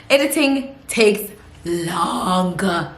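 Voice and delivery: very slow, stretching out LONGER; weary emphasis, words pulled long; drawn-out complaint. Real, not acted. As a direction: drag out "longer" to show the tedium. Complains about the chore of editing.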